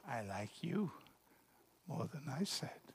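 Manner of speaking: soft, unvoiced confession; shy whisper. Shy girl whispers back to the boy.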